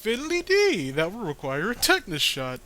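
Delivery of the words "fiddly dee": silly voice. Acted. A quoted reference in a funny voice.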